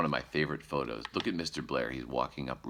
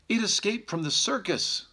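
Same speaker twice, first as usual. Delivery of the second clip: much higher, louder mother bear voice; 4 dB louder than usual. Acted. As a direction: squeak it out like a fussy mother bear. Missus Blair voiced in story.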